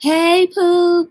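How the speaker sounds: lifted sing-song hello; Foodie's greeting. Retold or imitated. Copying a streamer's hello to her viewers, lilting. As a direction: toss out the greeting up high. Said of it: "That's how she says it".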